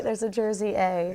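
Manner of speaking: broad New Jersey accent on "There's a Jersey A". Shown as a demonstration. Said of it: "Can you do a good Jersey accent?".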